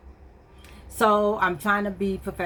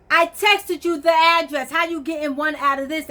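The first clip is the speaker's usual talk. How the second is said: exasperated scolding; 8 semitones higher, 5 dB louder than usual. Retold or imitated. To the late mechanic, an exasperated high scold. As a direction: let him have it for ignoring the text.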